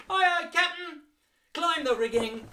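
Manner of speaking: high, loud deckhand shout; sailor salute. Shown as a demonstration. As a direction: salute the captain with gusto. Sailor reply and command.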